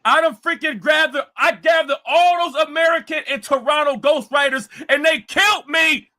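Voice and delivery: loud, high mocking whine of a beaten rival. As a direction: yell it like a sore loser crying to himself. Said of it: "You're like".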